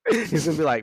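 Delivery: usual speaking voice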